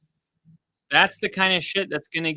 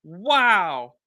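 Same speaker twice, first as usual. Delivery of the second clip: stunned, trailing off in disbelief; amazed wow; much slower than usual. Real, not acted. Reacting to amazing play.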